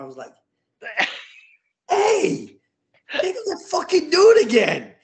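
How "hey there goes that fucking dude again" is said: very high, loud holler; excited call-out. Retold or imitated. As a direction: yell it out on spotting him. Acts out calling out on recognition.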